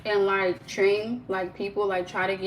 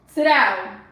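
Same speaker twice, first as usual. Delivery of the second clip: loud, sudden order; commanding the dogs; 8 dB louder than usual. Real, not acted. Owner to dogs who are not listening, loud command. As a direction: call out a firm, loud command.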